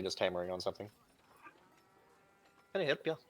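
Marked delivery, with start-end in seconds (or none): 2.7-3.2 friendly blacksmith with an Irish lilt; Irish accent. Acted. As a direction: greet the customer in a light Irish accent. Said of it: "sorry for my Irish accent".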